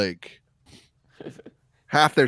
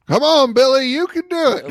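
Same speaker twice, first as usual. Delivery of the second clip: cheering on; 14 semitones higher, 3 dB louder than usual. Retold or imitated. Cops urging from the lake edge.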